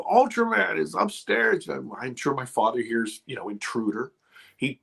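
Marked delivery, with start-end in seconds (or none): 0.0-1.7 put-on high, slow wail of a scared kid; a terrified little boy pleading, higher and drawn out; crying child's report. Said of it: "screaming, bawling".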